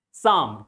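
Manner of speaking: Psalm said as "sahm", no P or L; silent letters. Shown as a demonstration. Teacher models the word for students, silent P and L in Psalm. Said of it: "both letters are silent".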